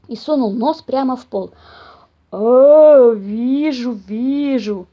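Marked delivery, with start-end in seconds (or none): 2.3-4.9 booming start, then drawn-out rumble; growling bear. A narrator's reading. Papa bear spotting mice on the floor, growling. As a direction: growl in sudden recognition. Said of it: "прорычал он".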